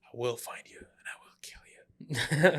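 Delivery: slow whispered film-villain threat; menacing movie threat. Acted. Quoting a film threat to a friend, menacing slow whisper. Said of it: "the other guy, like, who goes".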